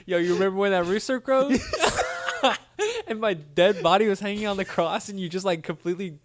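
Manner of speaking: giggly, playful Jesus teasing Peter; laughing teasing Jesus. Pretend. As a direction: rib a friend while laughing.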